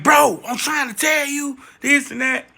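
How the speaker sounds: frustrated shout. Retold or imitated. Narrator yelling at the dog owner over the phone. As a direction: shout it slowly to get through to him.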